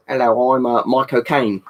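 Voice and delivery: lightly attempted Cockney impression; Michael Caine. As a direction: try a Cockney film-star voice. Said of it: "Mike O'Kane".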